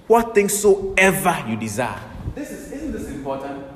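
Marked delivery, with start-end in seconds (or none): 0.1-2.0 intoned scripture. Acted. Preacher intoning the key verse.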